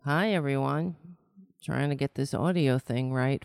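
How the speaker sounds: ordinary talk, nothing marked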